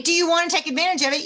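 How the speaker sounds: fast, loud hard sell; mock pushy salesperson; pushy wrong pitch. Shown as a demonstration. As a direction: rush and push the customer. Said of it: "You don't say".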